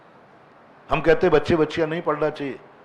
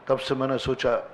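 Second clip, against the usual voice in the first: ordinary delivery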